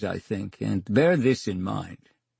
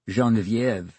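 ordinary delivery

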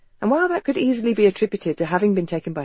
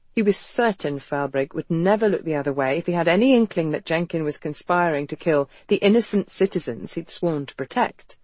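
ordinary delivery

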